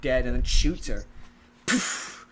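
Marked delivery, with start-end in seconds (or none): 1.6-2.3 whispered gunshot poof. Retold or imitated. Gunshot in retold scene.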